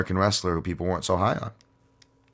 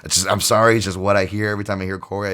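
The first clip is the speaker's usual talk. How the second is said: normal talking voice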